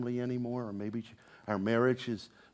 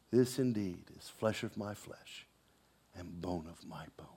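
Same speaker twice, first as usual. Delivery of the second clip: whisper; 5 semitones lower, 7 dB quieter than usual. Acted. Adam's tender words to Eve.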